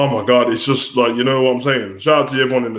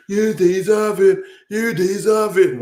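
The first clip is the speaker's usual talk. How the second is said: high, slow mock voice saying you deserve it; mocking fans; 8 semitones higher, slower than usual. Mimicking fans who hype any wrestler, high and slow. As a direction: drag it out like a gushing fan. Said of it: "it's like, this person should be champion".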